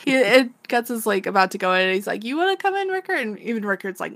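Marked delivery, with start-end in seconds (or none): 2.2-3.2 much higher, sing-song teasing voice; imitating a playful dare; mock teasing invite. Guts teasingly invites Rickard.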